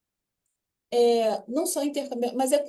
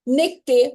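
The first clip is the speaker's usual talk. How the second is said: Necté, louder and joined; said as one block; 2 semitones higher, 6 dB louder than usual. Shown as a demonstration. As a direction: say Necté loudly as one piece. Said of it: "tudo junto". ("Necté" mais forte, tudo junto)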